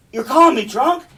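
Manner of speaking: high, loud incredulous question; indignant stage outburst; offended outrage. Acted. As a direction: take loud offence, rising at the end. Cassio offended at being called drunk.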